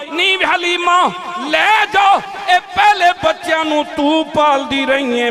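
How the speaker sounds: high, loud, slow preacher's cry; impassioned shout. Acted. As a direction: call out to Halima with all your force. Shouted call to Halima. (ऊंची ललकार, हौली हौली)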